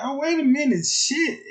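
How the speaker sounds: high, loud startled cry; sudden alarm. Retold or imitated. [name] realising he lost his girl, a loud startled cry. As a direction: catch it in sudden shock. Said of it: "I'm like".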